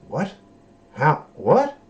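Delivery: incredulous rising what, how, what; baffled. Retold or imitated. Mocking the baffled viewer, incredulous. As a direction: sound completely lost by the scene.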